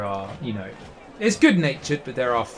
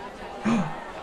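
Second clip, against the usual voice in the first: flattered gasp; 9 semitones higher, 8 dB quieter than usual. Acted. Saul flattered tusks noticed.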